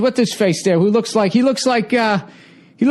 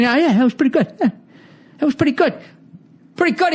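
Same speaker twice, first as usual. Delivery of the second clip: higher, laughing through a grudging compliment; a rattled brawler pretending he's fine, chuckling; high mock nod of respect, half-laughing; humbled fighter; 6 semitones higher, much faster than usual. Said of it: "He's got a little fucking".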